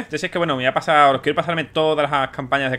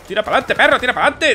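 high, louder push forward; yelling orders; 9 semitones higher, 4 dB louder than usual. Real, not acted. Player yelling at his teammate to move, loud. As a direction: bark at the dog of a soldier.

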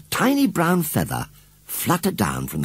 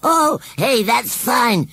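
much higher, louder cheering; encouraging shout; 5 dB louder, much slower than usual. A narrator's reading. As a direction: egg her on heartily. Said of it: "shouted Gummidge encouragingly".

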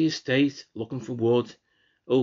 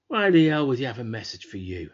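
scornful retort; 7 dB louder than usual. Acted. Brother scoffs at sister in quarrel.

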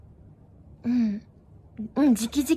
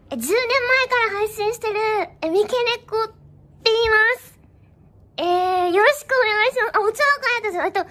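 高く張った声で元気に自己紹介; 初見さん向けに可愛く作った声; よそ行きの可愛い声; 5 semitones higher, 5 dB louder, faster than usual. Acted. 初見向けに可愛い声で自己紹介. (高めの配信者挨拶声)

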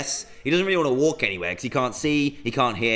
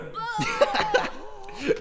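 squeaky high-pitched giggle; high giggle fit. Real, not acted. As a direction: giggle in delight at the scare. Jump scare from toilet.

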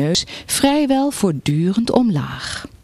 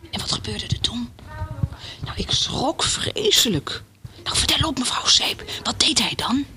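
soft, higher, breathy whisper; eager hushed questioning; 7 semitones higher, 6 dB quieter than usual. A narrator's reading. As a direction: press for the gossip under your breath. Neighbour eagerly urges whispered gossip on. (nieuwsgierig fluisterend)

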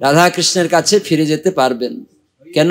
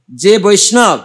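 ordinary talk

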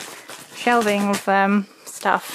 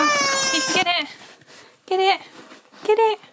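much higher, eager encouragement; coaxing dog voice; 10 semitones higher than usual. Real, not acted. Urging the husky to grab the toy, high and eager. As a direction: egg the dog on, sing-song.

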